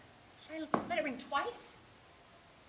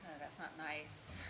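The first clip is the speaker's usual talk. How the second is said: ordinary talk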